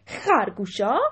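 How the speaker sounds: loud, indignant one-word query; angry question. A narrator's reading. Elephant king challenged by his subject, angry. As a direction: throw it back angrily as a question. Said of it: "با عصبانیت گفت".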